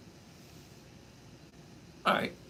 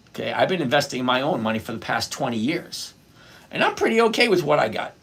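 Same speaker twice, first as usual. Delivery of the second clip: ordinary talk, nothing marked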